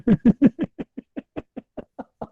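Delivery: loud, high mock villain laugh. (फ़िल्मी villain की हँसी)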